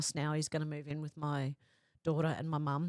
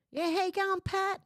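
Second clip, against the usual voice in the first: much higher put-on voice of a mate; cheeky impression of a chatty viewer; imitating a viewer; 13 semitones higher, 3 dB louder than usual. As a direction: mimic how he talks, pitched way up. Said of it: "he talks like this".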